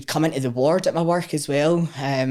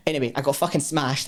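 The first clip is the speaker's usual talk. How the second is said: ordinary talk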